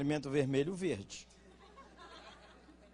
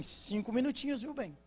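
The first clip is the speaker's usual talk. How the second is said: sweetly raised voice, like a wife stalling her husband; coaxing wife promising five little minutes; wife's five minutes; 7 semitones higher, 3 dB quieter than usual. Retold or imitated. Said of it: "Ela vai sair e fala com ele assim".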